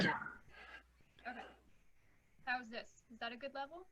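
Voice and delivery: faint, distant voice on a call; speaking from across the room; far from mic. Real, not acted. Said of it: "Sounds like you're far away".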